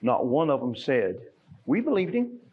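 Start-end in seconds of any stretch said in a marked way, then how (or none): 1.7-2.4 high. Acted. Missing line voiced by preacher.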